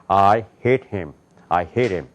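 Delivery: low "I hit him" from the throat; American "I hit him", pitched low and voiced deep in the throat; American-style English. Shown as a demonstration. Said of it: "영어는 이렇게 읽어요".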